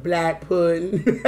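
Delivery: imitating Lele. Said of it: "How she said?".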